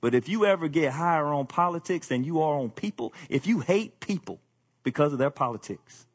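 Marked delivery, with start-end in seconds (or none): none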